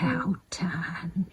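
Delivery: whispered praise. Real, not acted. Owner whispering praise to her cat. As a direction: breathe it to her gently.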